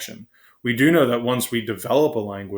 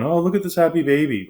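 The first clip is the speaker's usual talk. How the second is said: put-on higher, louder parent-to-baby voice; cooing to a baby; 7 semitones higher, 5 dB louder than usual. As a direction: coo admiringly over a baby, pitch lifted. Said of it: "if you're holding a baby and you're going".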